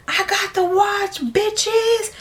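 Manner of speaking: high, drawn-out boast imitating him; hammed-up victory announcement; mock triumphant crowing. Retold triumphant boast after the club.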